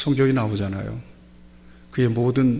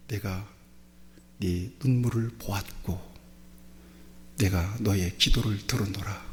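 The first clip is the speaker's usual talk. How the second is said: low, soft, measured words of God; a lightly voiced, hushed God; solemn divine voice; 5 semitones lower, 5 dB quieter than usual. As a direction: speak it gently as God comforting the king. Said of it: "그러면서 주신 말씀이".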